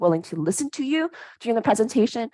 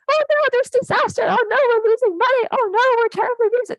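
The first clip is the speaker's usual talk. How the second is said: much higher, hammed-up panic; mock-panicked audience fearing disaster. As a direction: wail it like the sky is falling. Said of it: "be really upset".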